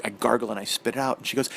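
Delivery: normal talking voice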